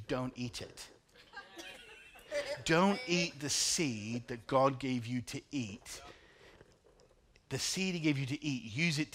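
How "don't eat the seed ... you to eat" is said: slow, weighty warning; slow emphatic warning. Shown as a demonstration. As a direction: lean on Don't, slowly. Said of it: "can I encourage you".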